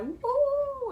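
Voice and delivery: a high whoop; cheer. Retold or imitated. Fan cheering as the cast comes out, high whoop. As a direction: whoop like a fan at the stage door.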